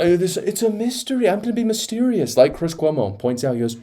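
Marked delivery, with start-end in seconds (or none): 0.0-2.4 high-pitched, sing-song mockery of being cryptic; mock mysterious. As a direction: imitate someone playing coy, pitched way up. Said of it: "wants to be all mysterious and say".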